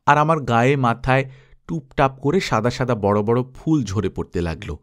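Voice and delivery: ordinary delivery, nothing marked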